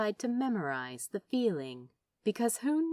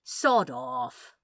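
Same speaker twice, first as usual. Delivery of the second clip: gruff dismissal; 5 semitones lower, 8 dB louder than usual. Acted. Gruff dismissal covering tears.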